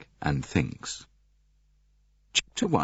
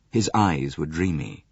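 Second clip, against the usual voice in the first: plain reading voice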